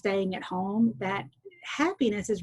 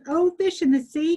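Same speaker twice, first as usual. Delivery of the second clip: high, louder call to the sea; 8 semitones higher, 5 dB louder than usual. Acted. Fisherman calls again to the magic fish, high call. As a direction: call out over the waves. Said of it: "and he called".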